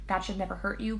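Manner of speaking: ordinary delivery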